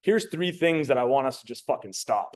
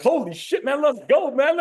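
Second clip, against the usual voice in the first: high, loud, hyped-up burst; pumped-up excitement; 12 semitones higher, 6 dB louder than usual. Retold or imitated. Man hyping himself up to a woman, pumped-up excited shout. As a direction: imitate a man getting fired up and loud. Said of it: "I get excited".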